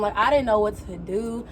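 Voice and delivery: ordinary delivery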